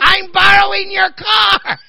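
high, loud, drawn-out holler; hammed-up mom calling out casually from afar; slow mock-cheery shout, way up high; imitating mother.

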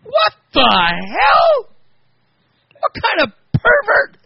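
wife explodes at the filthy text; outraged yell. Retold or imitated. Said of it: "And she goes".

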